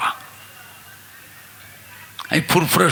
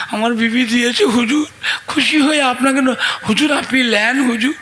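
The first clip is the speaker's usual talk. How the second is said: higher-pitched, earnest begging; pitched-up imploring, voiced as a disciple; acted pleading; 5 semitones higher than usual. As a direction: plead with a revered master to accept. Disciple pleads with hujur to accept.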